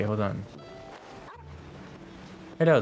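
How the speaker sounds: ordinary delivery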